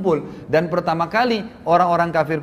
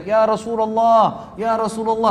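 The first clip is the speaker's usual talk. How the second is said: calling from afar; 5 semitones higher, slower than usual. Retold or imitated. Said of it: "dari jauh teriak".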